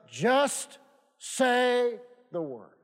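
JUST and SAY drawn out very slowly, "the word" dropping; slow, emphatic. Shown as a demonstration. Preacher quoting the centurion's great faith, slow and emphatic. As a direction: stretch out "just say", let "the word" fall. Preacher stressing the key verse.

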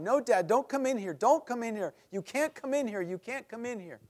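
high, pleading, tearful refusal; a scared son sobbing at dad through the door; crying boy. Retold or imitated. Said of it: "He's, he's crying".

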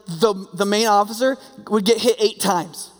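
ordinary talk, nothing marked

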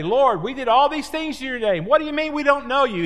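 high, hurried acted protest; indignant pleading. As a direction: plead your case in disbelief. The rejected protesting on judgment day.

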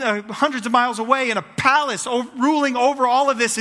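ordinary delivery

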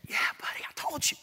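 put-on whispered aside to a small child; hushed reply. Dad reassures son at the pageant, whispering. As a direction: answer him under your breath.

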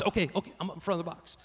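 put-on mumbled boyish compliance; meek reply. Boy quietly answering his dad, meek and soft.

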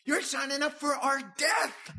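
high, loud mock-panicked cry; alarmed flesh. Flesh voiced by the pastor warning the believer, high alarmed cry. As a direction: cry it like the flesh terrified of dying.

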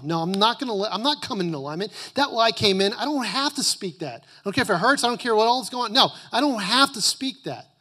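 high, firm refusal of a lie; defiant declaration. Pretend. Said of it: "what you're saying is".

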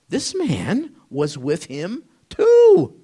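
single someone out, stretching the words out high; pointed accusation. Acted. Servant girl's accusation acted out.

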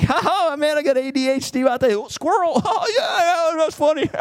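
high, laughing mock joke about ADHD; mocking laughter. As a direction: scoff at it like it's funny, giggling high. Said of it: "think it's a joke now".

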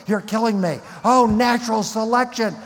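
high, loud hammed-up lament; mock cursing. Preacher mocks atheists blaming evolution, hammed-up wail. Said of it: "why don't you blame the planet".

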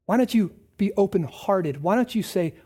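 ordinary talk

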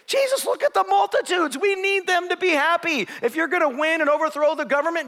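pleading disciples. Acted. Disciples begging Jesus to please the multitudes, high and loud. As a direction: push him hard to see the crowds.

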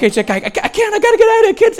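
high, stammering mock panic; imitated flipping out. Wife fleeing the spider, flipping out. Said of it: "she's flipping out".

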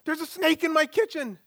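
mock-panicked mother, very high and loud; hammed-up high-pitched alarm; panicked mom. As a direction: shriek it like mom spotting a snake. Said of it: "I hear this panicked voice".

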